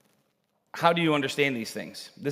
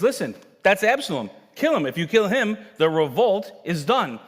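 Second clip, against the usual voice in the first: higher, louder order to kill; urgent commands; 7 semitones higher, 5 dB louder than usual. Retold or imitated. General ordering the soldier to kill the king's son. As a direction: bark the order, voice climbing on kill. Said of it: "he says".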